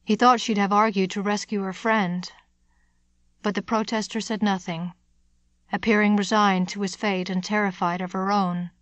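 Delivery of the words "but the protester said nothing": ordinary delivery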